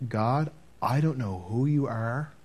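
soft, slow retold prayer; scared teenager praying alone in the car; hushed prayer. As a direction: pray it quietly, unsure. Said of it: "and I prayed".

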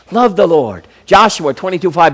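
usual speaking voice

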